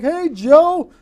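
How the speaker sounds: high, loud hail to a friend; acted-out holler hello; calling out a greeting. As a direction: call out as if across a crowd.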